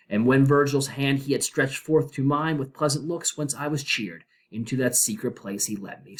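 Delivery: solemn, measured reading of verse; dramatic poem reading. Acted. Teacher reading the Inferno aloud to class, solemn and measured. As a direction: intone it like words carved over a gate.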